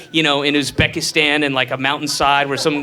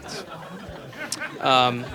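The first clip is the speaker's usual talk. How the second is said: normal talking voice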